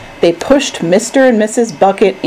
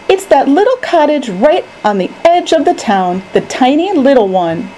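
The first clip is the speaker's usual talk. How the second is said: put-on higher, lightly excited boy; single it out eagerly from above; eager pointing out; 6 semitones higher than usual. A narrator's reading. Charlie points out his house.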